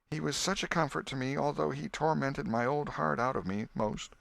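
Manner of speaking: put-on high, grieving old aunt; tearful old aunt. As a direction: hold back tears, pleading. Aunt Polly grieving voiced.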